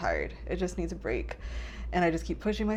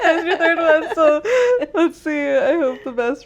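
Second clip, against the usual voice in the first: loud, high laughing tease; 9 semitones higher, 14 dB louder than usual. Real, not acted. Teasing her cohost about her third boyfriend, laughing loudly. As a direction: giggle the words out at her.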